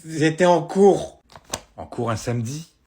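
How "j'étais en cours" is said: loud, slow lisp; lisped excuse, drawn out and loud. Acted. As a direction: force the words out past a sore tongue piercing. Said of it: "Pourquoi tu zozotes?".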